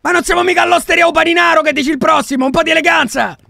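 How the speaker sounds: high, loud scolding; indignant shout. Acted. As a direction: shout for some class in the place. Indignant telling-off of shouting colleague.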